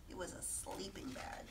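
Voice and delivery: conspiratorial whisper; whispered aside. A narrator's reading. Reading to children, whispering the answer. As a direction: share the secret in a whisper. Hushed aside to young listeners.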